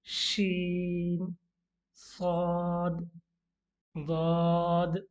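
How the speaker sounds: शीन साद दाद each stretched very slowly; drawn-out letter names. Shown as a demonstration. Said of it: "repeat कर लेते हैं".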